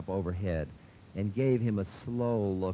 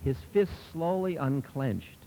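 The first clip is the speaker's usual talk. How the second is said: usual speaking voice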